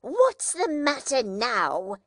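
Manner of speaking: the sister losing patience; sharper demand. A narrator's reading. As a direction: repeat the question with rising temper. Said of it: "more sharply than before".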